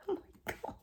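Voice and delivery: breathy whispered exclamation; whispering. Real, not acted. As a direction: whisper it after sneezing. After sneeze, whispered.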